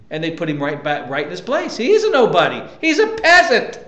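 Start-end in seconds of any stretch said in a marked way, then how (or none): 1.8-3.8 scornful, higher-pitched put-down; mock scornful dismissal. Putting a servant right back in his place, scornful. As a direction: dismiss him like he is nothing.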